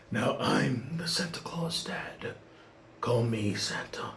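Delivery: slow, sinister whispered villain line; Scar-style villain. Pretend. Son gloating over his father as Scar, whispered villain. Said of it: "Like Scar from The Lion King".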